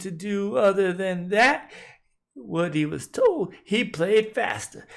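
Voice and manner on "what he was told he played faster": usual speaking voice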